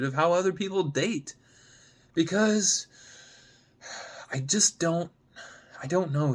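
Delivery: a hurt woman who can't find the words; upset Gemma. Acted. Gemma unravelling as she argues with Sam, upset outburst.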